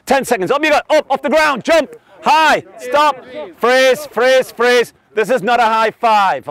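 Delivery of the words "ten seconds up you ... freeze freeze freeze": raised-pitch coaching calls; calling out commands. Real, not acted. Coach starts and stops a high-five game, calling out commands. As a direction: call short, urgent orders to a group.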